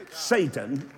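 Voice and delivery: usual speaking voice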